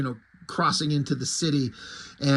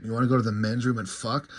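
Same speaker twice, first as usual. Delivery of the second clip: low, quick pickup mumble; lightly voiced barfly hitting on someone; crude pickup line; 6 semitones lower, 4 dB quieter, faster than usual. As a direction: toss off a crude come-on, lower and faster. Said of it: "he's hitting on her".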